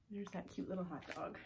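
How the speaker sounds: plain reading voice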